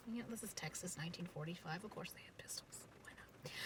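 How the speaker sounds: very soft, near-whispered aside; barely voiced, offhand remark; hushed aside. Real, not acted. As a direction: drop to a murmur for a side comment. Throwaway aside about the pistols.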